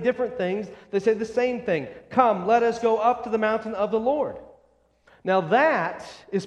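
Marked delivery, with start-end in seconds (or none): none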